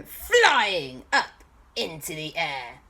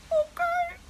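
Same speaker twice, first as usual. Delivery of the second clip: very high tiny voice; squeaky okay. Acted. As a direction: answer timidly. Bob answers timidly.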